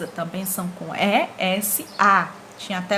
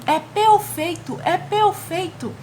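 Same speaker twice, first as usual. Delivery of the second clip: higher, slow, savoring praise; drawn out, admiring, higher; exclaiming delight; 9 semitones higher, slower than usual. Retold or imitated. Teacher re-quoting the exclamation, slow and delighted. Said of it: "é uma frase o quê? Exclamativa".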